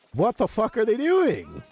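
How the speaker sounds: high, loud disbelieving outburst; shocked disbelief. Real, not acted. Streamer shocked by a crash clip, high disbelieving outburst. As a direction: blurt it out, stunned by the clip.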